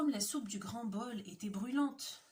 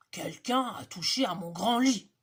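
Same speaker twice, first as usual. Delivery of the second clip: much louder booming bear; big bear finding his bed touched; 9 dB louder than usual. Acted. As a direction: boom like an angry papa bear.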